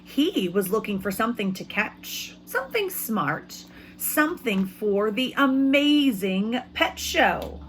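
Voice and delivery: slow, lively storybook reading; reading to children. A narrator's reading. Teacher reading a picture book to her class, lively storytelling.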